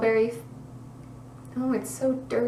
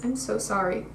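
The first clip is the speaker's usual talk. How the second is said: ordinary talk, nothing marked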